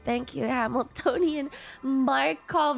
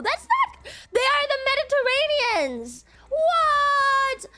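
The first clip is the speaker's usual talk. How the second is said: indignant squeak; 10 semitones higher, slower than usual. Real, not acted. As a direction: squeal in disbelief. Indignant outburst.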